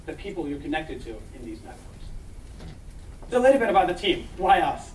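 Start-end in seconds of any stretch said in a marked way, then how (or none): none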